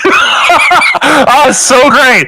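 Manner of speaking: delighted laughing praise; laughing, thrilled at the player's joke. Real, not acted.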